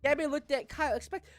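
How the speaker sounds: usual speaking voice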